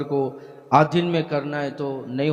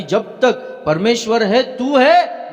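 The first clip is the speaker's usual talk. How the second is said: building louder and higher; emphatic retold vow; 8 semitones higher, 8 dB louder, slower than usual. Elisha vows to stay with Elijah, retold with rising force. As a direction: drive the vow up to a loud peak.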